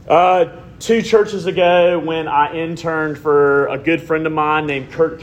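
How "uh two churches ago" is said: ordinary talk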